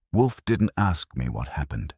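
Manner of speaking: ordinary delivery, nothing marked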